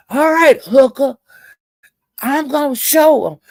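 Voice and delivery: high, loud, imitated threat; hammed-up taunt with a threat behind it; mock threatening yell. Host threatening her on his panel, a high, loud mock yell.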